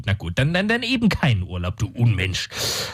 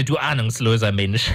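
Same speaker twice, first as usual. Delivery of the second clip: normal talking voice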